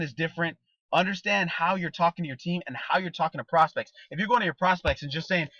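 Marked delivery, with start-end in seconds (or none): none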